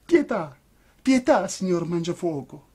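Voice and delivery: high, tearful begging; pleading puppet in tears. A narrator's reading. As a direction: beg on your knees. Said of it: "con voce supplichevole".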